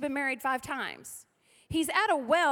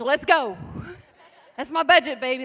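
louder, laughing eagerness; eager yes; 9 dB louder than usual. Retold or imitated. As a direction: jump at the offer, laughing. Mom eager to go thrifting.

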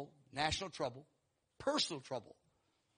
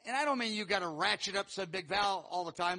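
ordinary talk, nothing marked

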